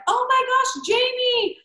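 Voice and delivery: high, squealing recognition of the name; delighted surprise. Retold or imitated. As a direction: squeal it up high at a friend's face. Surprised seeing officiant at aisle.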